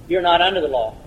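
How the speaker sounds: "You're not under the law" in one quick, casual run; quick and glib. Real, not acted. Preacher states a simple answer too glibly to sink in, quick and offhand. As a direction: toss off "You're not under the law" lightly, without pauses. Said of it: "I can say that so fast and so glib".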